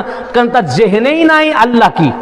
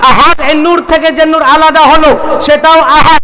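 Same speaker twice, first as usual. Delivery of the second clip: raised, ringing sermon voice, driving each line; fervent preaching; 4 semitones higher, 3 dB louder than usual. Acted. Preacher declaring God's oneness to the congregation, fervent and raised. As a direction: preach it out to the gathering, building fervour. Preacher back at full force.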